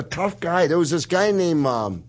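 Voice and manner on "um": ordinary talk, nothing marked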